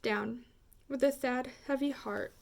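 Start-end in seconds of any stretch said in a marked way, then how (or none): none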